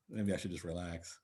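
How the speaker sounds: normal talking voice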